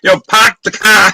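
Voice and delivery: "park the car" with dropped Rs, "pahk the cah"; Boston accent. Shown as a demonstration. Showing his friend his Boston accent, dropped Rs. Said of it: "Boston accent".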